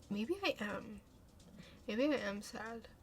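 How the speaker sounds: soft, quiet admission. Real, not acted. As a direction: admit it quietly to yourself. Quiet realization.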